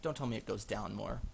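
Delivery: ordinary talk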